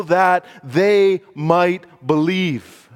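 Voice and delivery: drawn out, high and loud; preacher slowing right down for the key line; slow emphatic climax. Acted. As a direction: lean on every word to land the point. Climax of the point.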